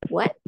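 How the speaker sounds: "What" swept upward; mock surprise. Shown as a demonstration. Teacher showing tone two rises, surprised "What". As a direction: ask "What" as if startled. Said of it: "jadi dia naik ke atas".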